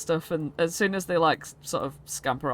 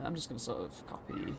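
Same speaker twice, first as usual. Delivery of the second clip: ordinary talk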